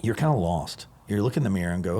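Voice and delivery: ordinary delivery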